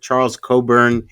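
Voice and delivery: ordinary talk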